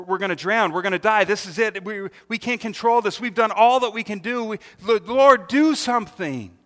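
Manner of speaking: loud, rising panic, pleading at the end; panicked cry. Acted. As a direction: shout it like the boat is sinking. Disciples panic in sinking boat.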